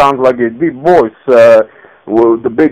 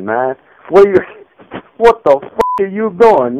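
normal talking voice

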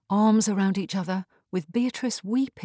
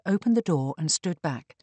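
usual speaking voice

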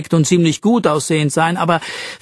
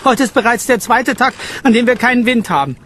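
higher, louder, fast indignant grumbling; a pompous man airing grievances; higher, louder indignant complaint; 8 semitones higher, 4 dB louder than usual. Acted. Fitzwilliam complains on.

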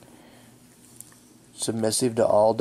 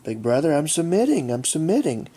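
higher, louder mock-pleading little dog voice; voicing the pleading dog; 6 semitones higher, 4 dB louder than usual. Owner voicing his dog begging big brother, mock pleading. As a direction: plead like a pup showing her belly. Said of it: "slide around on the ground like".

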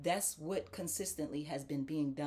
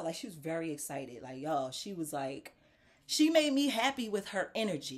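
ordinary delivery

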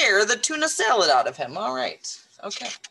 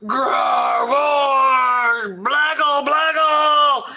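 loud, drawn-out monster growling and babbling; monster roar; 4 semitones higher, 5 dB louder, much slower than usual. Acted. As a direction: roar nonsense to frighten a child. Monster roars to scare boy.

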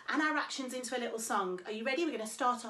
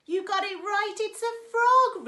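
excited reveal; 8 semitones higher, 9 dB louder than usual. Real, not acted. Swim teacher cheering children who guessed the frog. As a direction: cheer the right answer.

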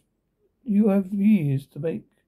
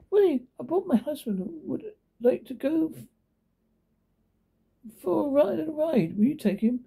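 higher voice for the scheming lady; lady voiced noticeably higher; rich lady; 10 semitones higher than usual. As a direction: pitch up as the cool rich lady making an offer.

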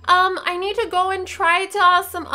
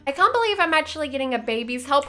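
ordinary talk

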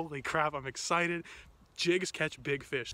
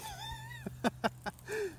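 high disbelieving laugh; 8 dB quieter than usual. Real, not acted. Disbelief at tiny fish caught.

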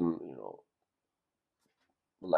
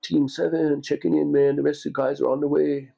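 laid-back surfer dude; 8 semitones higher, 5 dB louder than usual. Retold or imitated. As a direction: play the skinny blonde SEAL all chill and casual. SEAL at armory door, surfer-dude quote.

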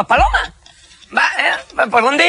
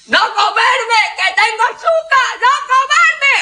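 high, hammed-up cries; mock panicked pleading; 10 semitones higher, slower than usual. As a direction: beg not to be eaten, then whoop. Captive pleading from the pot. (súplica exagerada y aguda)

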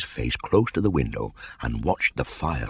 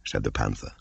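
ordinary delivery, nothing marked

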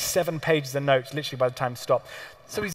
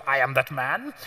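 posh instructor voice; 2 semitones lower than usual. Retold or imitated. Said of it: "speaks with the most outrageous accent".

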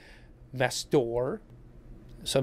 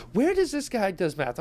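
incredulous; 6 semitones higher than usual. Real, not acted. Incredulous at a commenter's figures.

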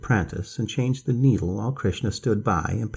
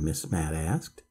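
usual speaking voice

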